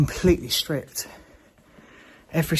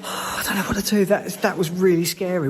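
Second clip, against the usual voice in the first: usual speaking voice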